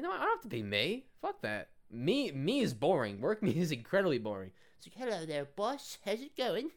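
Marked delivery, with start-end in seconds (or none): 0.0-4.5 high, loud put-on voice; answering her claim that you must be yourself, hammed-up; mock defiant voice. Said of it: "I'm like". 5.1-6.7 high put-on British accent; a light posh British greeting; a silly high British accent, greeting the boss. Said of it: "That was, like, British too, apparently".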